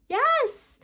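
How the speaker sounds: normal talking voice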